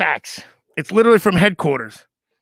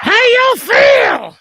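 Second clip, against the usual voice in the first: much higher, slow drawn-out shout; hype shout; 13 semitones higher, 7 dB louder, much slower than usual. Acted. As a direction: call out to the crowd. Hype call to audience.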